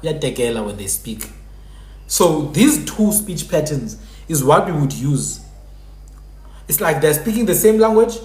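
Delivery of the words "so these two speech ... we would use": usual speaking voice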